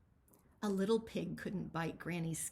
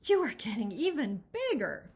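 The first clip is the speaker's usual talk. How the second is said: panting; 3 semitones higher, 7 dB louder than usual. Acted. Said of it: "panted Granny".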